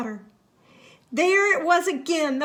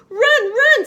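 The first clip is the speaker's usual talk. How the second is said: high, loud cry to flee; panicked shout; 11 semitones higher, 10 dB louder than usual. Acted. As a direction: yell it in terror. Hedgehog panics.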